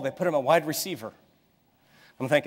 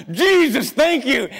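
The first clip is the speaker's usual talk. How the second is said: higher and louder, a thankful cry; relieved exclamation; 9 semitones higher, 6 dB louder, slower than usual. Retold or imitated. Father thanks God as his son catches the ball, relieved cry.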